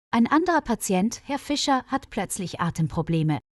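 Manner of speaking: usual speaking voice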